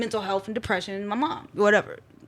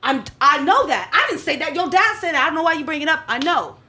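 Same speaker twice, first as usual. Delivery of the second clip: higher and louder, mom snapping back defensively; 5 semitones higher, 4 dB louder than usual. Retold or imitated. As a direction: deflect onto dad, flustered.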